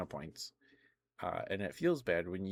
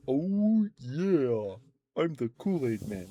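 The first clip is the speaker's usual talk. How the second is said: booming, much higher mascot bellow; hammed-up cartoon mascot roar; Kool-Aid Man; 6 semitones higher, 7 dB louder, slower than usual. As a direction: belt it out like the Kool-Aid Man bursting through a wall. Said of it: "That was terrible".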